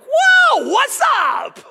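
high, loud, falsetto whoa; relieved cheery greeting. Retold or imitated. The relieved preacher greets a cheerful member, bright and loud. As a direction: burst out in happy relief. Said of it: "he's like".

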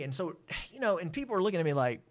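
ordinary delivery

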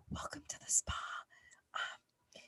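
ASMR spa host whisper; soft spa whisper. Retold or imitated. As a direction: welcome them in a hushed spa whisper. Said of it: "just talking in the microphone".

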